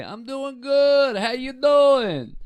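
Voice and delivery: high, slow, loud mock yell; imitating a shouting customer, drawn out. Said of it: "he just yells".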